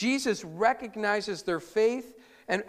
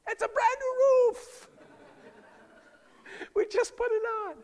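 very high, hammed-up outraged owner; comic owner voice; 13 semitones higher than usual. Homeowner yelling at the men on his roof, in a very high, hammed-up voice. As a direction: squeal like the owner seeing his new roof torn up. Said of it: "why they talk like this".

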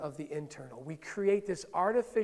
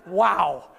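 mock shock; 8 dB louder than usual. Imagined shocked reaction.

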